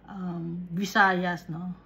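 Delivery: ordinary talk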